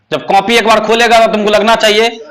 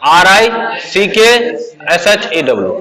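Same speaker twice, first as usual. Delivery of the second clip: slow spelling out. Shown as a demonstration. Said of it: "क्या हो जाएगा?".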